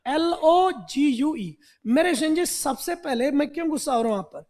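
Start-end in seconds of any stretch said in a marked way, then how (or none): 0.1-1.6 letters of LOGUE spelled one by one, slowly; spelling out slowly. Shown as a demonstration. Teacher spelling a word for students to memorise, slowly. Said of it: "ये word याद करना".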